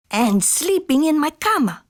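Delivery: high, indignant little voice; declaring little dog. A narrator's reading. Seventh amigo discovers someone in his bed, high declaring. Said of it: "declared the seventh".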